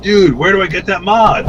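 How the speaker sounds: high, eager imitation of a fan; mock eager fan. Fans still asking where to buy the mod, high and eager. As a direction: play a fan begging for the mod. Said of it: "They're like".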